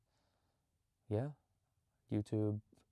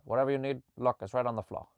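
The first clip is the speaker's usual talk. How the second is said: put-on crazy old Italian guy; 3 semitones higher, 8 dB louder, faster than usual. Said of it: "some crazy old Italian guy".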